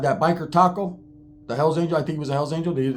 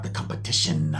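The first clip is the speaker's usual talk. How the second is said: very low, softer biker imitation; big biker voice; 13 semitones lower, 6 dB quieter than usual. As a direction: drop deep into the old biker's voice. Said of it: "that's how he talked".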